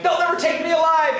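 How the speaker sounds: high, hammed-up last-stand cry; mock defiance. Cornered follower before jumping out the window, mock-defiant.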